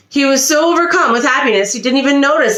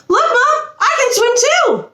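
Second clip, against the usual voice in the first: much higher, eager little duckling calling out; calling out to mom. A narrator's reading. Duckling calls to Mother Duck across the lake, high and eager. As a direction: squeak it up high like a small duckling shouting to mother. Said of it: "he called".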